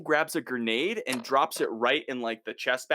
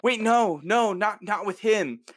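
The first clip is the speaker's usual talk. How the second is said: high, louder frantic protest; higher, louder panicked no; panicked refusal; 8 semitones higher, 3 dB louder, slower than usual. Retold or imitated. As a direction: stop him in a panic. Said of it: "Lucy's like".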